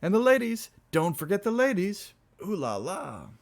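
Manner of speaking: very high, excited whoop; drunken toast. Acted. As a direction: squeal it out like a tipsy reveller. Drunken revellers shouting toasts.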